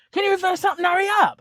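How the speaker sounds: high, loud, fast mock of a rude young man; rude demand. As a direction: snap it out impatiently. Young man repeats demand, quoted.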